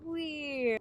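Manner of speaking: normal talking voice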